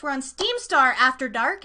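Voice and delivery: normal talking voice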